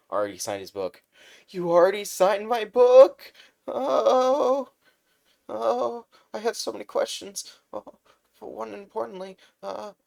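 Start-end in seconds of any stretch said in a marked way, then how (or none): 1.5-9.9 much higher, louder excited chatter; gushing fanboy. Acted. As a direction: gush with too many questions. Fan gushing at hero.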